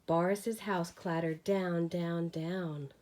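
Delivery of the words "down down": plain reading voice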